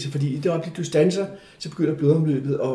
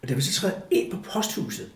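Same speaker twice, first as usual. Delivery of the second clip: usual speaking voice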